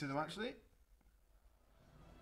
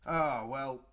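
ordinary delivery

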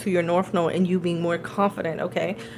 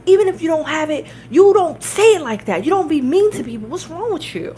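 high, raised-voice scolding; retold furious telling-off, pitched way up; imitated screaming; 8 semitones higher, 5 dB louder than usual. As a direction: imitate a boss yelling at his employee. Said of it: "the owner screaming".

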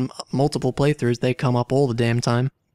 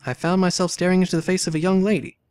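reads the opening lines aloud with lifted energy; story narration; 6 semitones higher, 3 dB louder than usual. A narrator's reading. Player narrating the game's story to viewers, animated storytelling. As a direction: tell it like an adventure novel.

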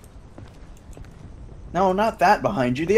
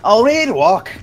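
put-on loud, higher try at a Scottish accent; attempted Scottish accent; 6 semitones higher, 7 dB louder, slower than usual. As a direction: have a clumsy go at Scottish. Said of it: "I can't do that".

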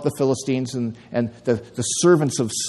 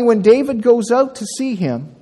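ordinary talk

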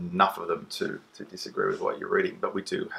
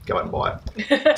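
ad voice; 4 dB louder than usual. Pretend. Mock ad sign-off.

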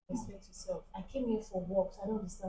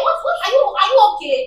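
loud, high, stammered challenge; outraged disbelief; 6 semitones higher, 7 dB louder than usual. Acted. Barber outraged at client's demand for services, loud and high.

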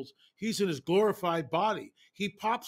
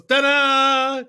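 higher, louder showman's ta-da; mock ta-da; 8 semitones higher, 11 dB louder than usual. Jesus popping through the wall to surprise the disciples, hammed-up ta-da. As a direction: pop out like a magician. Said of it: "He just goes".

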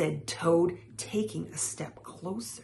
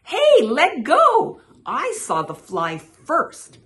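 loud, high protest that eases down; indignant frog; 9 semitones higher, 13 dB louder than usual. A narrator's reading. Frog to rival over a caught fly, loud and indignant. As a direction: call out in annoyance, high then settling. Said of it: "mumbled Frog".

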